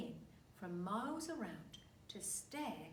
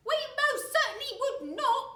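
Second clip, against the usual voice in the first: high, loud insistent wife voice; crying out as Mrs Twit; 16 dB louder than usual. A narrator's reading. Wife insisting she must not float away. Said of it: "cried Missus Twit".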